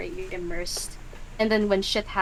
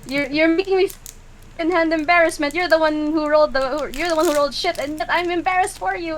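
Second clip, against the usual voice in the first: high, fast embarrassed rant; cringing player; 7 semitones higher, 6 dB louder, faster than usual. Acted. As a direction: whine from secondhand embarrassment. Player cringing against the wall.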